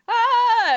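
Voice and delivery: high startled yelp, loud; frightened yelp. Acted. Small creature yelps as Rex walks towards her. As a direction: squeal "Ah" in sudden fright.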